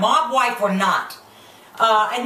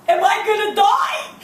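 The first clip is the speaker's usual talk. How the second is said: put-on scared kid; 10 semitones higher than usual. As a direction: cry out like a panicked kid, hammed up. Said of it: "kid is probably sitting there going like".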